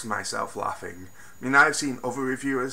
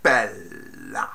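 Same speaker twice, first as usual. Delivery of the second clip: low, drawn-out campy "Bella" with a rising lilt; camp Bella inflection; 7 semitones lower than usual. Retold or imitated. Reviewer mimicking the villain's campy Bella. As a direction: purr "Bella" low and theatrical, rising at the end. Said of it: "I love the way he says Bella".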